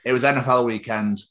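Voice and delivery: ordinary talk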